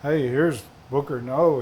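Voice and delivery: usual speaking voice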